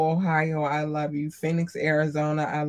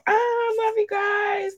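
squeaky cute voice; slower than usual. Acted. Cutesy thanks to listeners.

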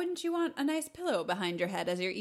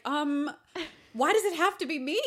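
timid guy voice; 7 semitones higher, 4 dB louder than usual. Retold or imitated. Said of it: "He's like".

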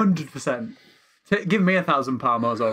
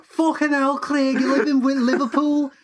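exasperated Scouse scolding; 14 semitones higher than usual. Acted. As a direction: scold Craig in a broad Liverpool accent. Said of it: "Why have we made him Scouse?".

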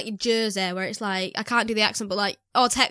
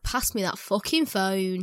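low, slow, softer swaggering demand; mock demanding; 4 semitones lower, 5 dB quieter, slower than usual. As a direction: imitate someone coolly demanding the phone. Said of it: "now I'm like".

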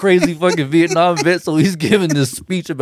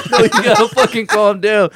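higher, agitated retort with a laugh in it; mock exasperation; 6 semitones higher than usual. Exasperated friend in the car arguing back, laughing.

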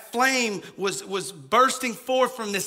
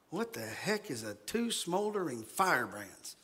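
low, soft confused murmur; soft puzzled aside; 5 semitones lower, 10 dB quieter than usual. Retold or imitated. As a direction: mutter your confusion. Listener's confused thought.

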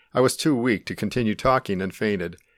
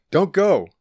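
high, louder urgent cry; raised-pitch yell; yelled plea; 8 semitones higher, 5 dB louder than usual. A narrator's reading. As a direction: call out, begging them not to leave. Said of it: "he yelled".

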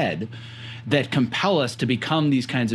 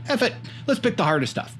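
higher-pitched, throwaway bravado; gung-ho imagined quote; 10 semitones higher than usual. Retold or imitated. Host voicing guest's choice to take the harder sport, gung-ho. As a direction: voice someone's reckless decision. Voicing the listener's defiant decision.

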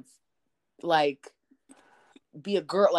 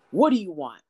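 retold snap; 2 semitones higher, 7 dB louder than usual. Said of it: "she goes".

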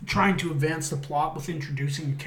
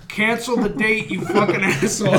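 higher, louder, laughing; mock alien; 6 semitones higher, 8 dB louder than usual. Alien insulting him, laughing mock voice. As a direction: play the rude alien, laughing.

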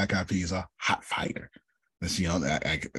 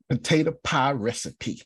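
ordinary delivery

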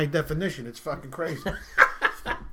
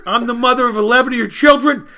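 loud, higher, hammed-up tirade; furious wife; 6 semitones higher, 10 dB louder than usual. Pregnant wife of eleven answers her husband, loud mock fury. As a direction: fire back, building to a yelled punchline.

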